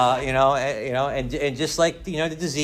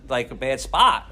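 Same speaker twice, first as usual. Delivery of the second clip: ordinary talk, nothing marked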